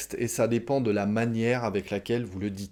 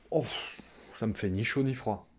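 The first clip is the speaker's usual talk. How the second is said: soft bored "pff" and shrug of a reply; indifferent sigh; 6 dB quieter than usual. Retold or imitated. Partner told gossip, answering with soft bored indifference. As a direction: brush it off softly with a sigh.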